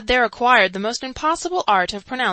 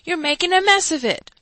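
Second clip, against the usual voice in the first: louder, higher cross aside; louder gruff scolding; gruff rebuke; 9 semitones higher, 6 dB louder than usual. A narrator's reading. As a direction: scold him through your teeth. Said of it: "growled the man under the window".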